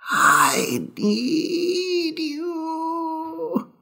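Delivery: slow, whispered lament rising to a high crying plea; whispering ghost. Acted. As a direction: breathe it out, then wail the need.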